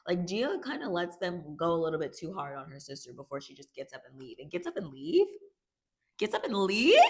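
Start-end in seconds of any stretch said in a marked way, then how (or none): 4.4-7.0 high rising question, growing louder; incredulous. Real, not acted. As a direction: repeat it in mounting disbelief. Repeats own words in mounting disbelief.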